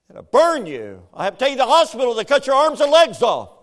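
loud, fast, high exaggerated warning; mock scare tactic. Parent to toddler near the fire, fast loud mock threat. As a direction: rattle off an over-the-top threat. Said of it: "I ran up there and went".

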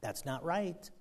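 soft, loving correction; quiet loving rebuke; gentle correction. Retold or imitated. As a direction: point it out tenderly. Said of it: "does it in a loving way".

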